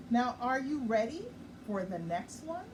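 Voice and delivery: ordinary delivery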